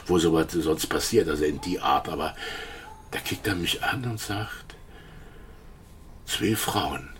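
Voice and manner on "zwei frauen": slow, quiet, ending in a whisper; hushed confession. Retold or imitated. Witness quoting Starke's confession, hushed and slow. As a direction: let the confession sink to a whisper.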